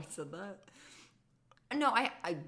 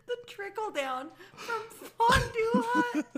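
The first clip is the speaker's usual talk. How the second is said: high, squeaky words through helpless laughter; laughing while talking; 9 dB louder than usual. Real, not acted. Friend cracking up over a prince's career plans, laughing helplessly. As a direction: squeeze it out between fits of laughter. Laughing too hard to talk.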